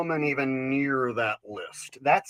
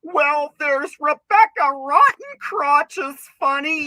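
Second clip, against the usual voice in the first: high, slow, loud ditzy lady; mock lady voice; 10 semitones higher, 7 dB louder, slower than usual. Comic mocking a woman naming a comedian, high and slow. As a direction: drag it out in a silly lady voice. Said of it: "some fucking stupid lady is gonna be like".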